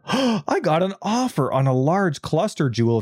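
high, thrilled announcement; excited gasp. Real, not acted. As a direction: gasp then blurt it out high. Sudden excitement at trade offer.

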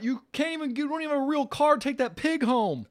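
high, loud mocking heckler voice; jeering at him from outside the bar; taunting hecklers. Bar crowd taunting Rocky.

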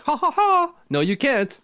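high gloating fake laugh; put-on high jeer; mocking taunt. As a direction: laugh villainously, then gleefully deny them. Mocking taunt voiced for the new owner.

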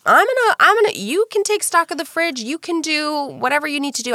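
high-pitched retold handover, settling gradually; acted encouragement. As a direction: hand over the job with exaggerated generosity. Retold handing over groceries to husband.

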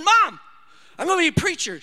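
high, loud announcement to mom; excited announcement. Retold or imitated. Son bursting in on his sleeping mom, loud and excited. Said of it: "I said".

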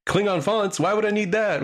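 high, incredulous mock question; mock incredulity. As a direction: pitch up in mock disbelief. Mocking phone update prompt.

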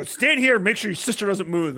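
put-on loud, high instruction to a kid; urgent order. Dad orders his son loudly to watch his sister. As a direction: bark the order in a rush. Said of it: "I go".

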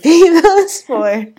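laughing through the words; real laughter breaking into the line. Real, not acted. Friend laughing while telling what counts as knowing a language.

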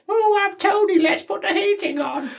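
high mocking posh voice; impression of Tony. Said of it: "bang on impression of you".

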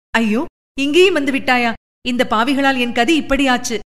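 ordinary delivery

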